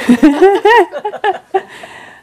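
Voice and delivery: loud, high, open laugh; high burst of laughter. Real, not acted. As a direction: let a real laugh burst out.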